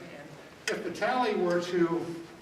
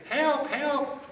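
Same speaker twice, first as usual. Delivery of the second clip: high, loud cry of help; child calling for help; 11 semitones higher, 6 dB louder than usual. Shown as a demonstration. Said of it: "kids could say".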